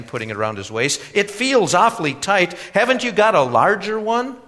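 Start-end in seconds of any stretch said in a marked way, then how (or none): 1.1-4.4 put-on high, loud young man's complaint; complaining young man. A narrator's reading. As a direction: complain about the squeeze, pitched up. Young man complains of tight belt.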